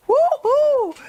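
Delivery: high, loud woo-hoo cheer; celebratory whoop. Real, not acted. Host celebrating making it to an hour, whooping.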